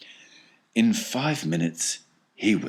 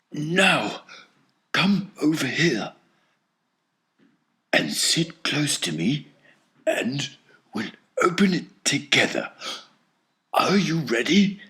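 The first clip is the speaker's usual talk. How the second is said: put-on an excited old man, voice higher; eager old grandfather; 8 semitones higher than usual. A narrator's reading. Grandpa Joe calls Charlie close to open the bar together.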